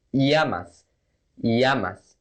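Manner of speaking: llamas with a Y sound, "yamas"; fast native llamas, "yah-mas". Shown as a demonstration. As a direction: llamas said quick, LL like a Y. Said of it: "talking fast".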